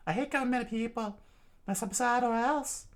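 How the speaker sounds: high, quick cold threat; high voice, fast and menacing; high threatening voice. Acted. Robin threatens.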